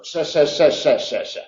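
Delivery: sa, sa said with a northern apical S; tongue tip on the ridge for sa, sa; alveolar S. Shown as a demonstration. Teacher demonstrating the northern alveolar S. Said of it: "ponemos la punta en los alveolos".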